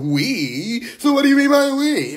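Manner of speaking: hammed-up doubting outsider; suspicious mock. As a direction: pounce on the word like a suspicious skeptic. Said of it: "'cause everyone's like".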